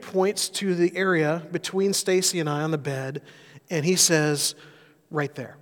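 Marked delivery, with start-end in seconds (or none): 5.1-5.6 a soft, small hint of a toddler asking; quietly voicing little David's request; quiet child's voice. Dad imitating son David at bedtime, softly and lightly. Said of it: "In a much cuter voice than that".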